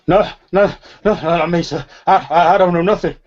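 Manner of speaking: high, loud, stammering panic; petrified. Acted. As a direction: protest in terror, tripping over the words. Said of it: "he looks absolutely petrified".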